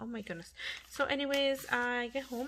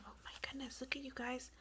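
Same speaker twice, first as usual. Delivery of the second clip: soft, half-whispered amazement; real hushed near-whisper; 3 semitones higher, 7 dB quieter, faster than usual. Real, not acted. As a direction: whisper it so the house stays asleep. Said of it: "apologize if I'm whispering".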